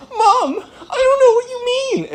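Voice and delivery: very high whiny mock wail; hammed-up squealing sob; crying kid. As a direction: imitate a kid tearfully denying it.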